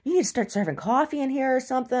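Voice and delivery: mock customer voice. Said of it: "was like".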